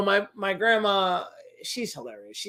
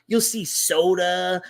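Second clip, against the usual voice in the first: usual speaking voice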